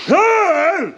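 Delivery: high, loud shouting for help; yelling out through a broken jaw; retold shout. Man with a broken jaw calling out, loud shout. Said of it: "I was trying to shout".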